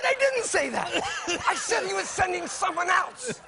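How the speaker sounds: mock outrage at the accusation; indignant protest. Husband protesting to his wife he never said it.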